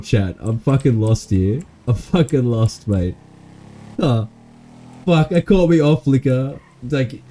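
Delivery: ordinary talk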